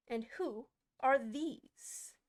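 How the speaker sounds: slow, loud, haughty demand; imperious queen. Acted. Queen demanding to know of the gardeners, haughty.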